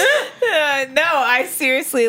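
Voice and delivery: high laughing voice. Real, not acted. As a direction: laugh while insisting. Laughing retelling pain.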